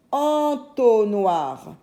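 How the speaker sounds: entonnoir said slowly as one word; stretched syllables. Shown as a demonstration. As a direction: draw out "Entonnoir" for the listener. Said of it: "dans la deuxième syllabe". (entonnoir prononcé lentement, d'un seul tenant)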